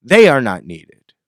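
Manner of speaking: loud punch on "They", falling away; loud, final put-down; emphatic dismissal. Real, not acted. Musician writing off promoters, emphatic dismissal.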